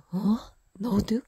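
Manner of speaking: sudden startled "어? 너도?" jumping up in pitch and volume; surprised. Retold or imitated. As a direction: caught off guard, blurt it out. Shocked reply to confession.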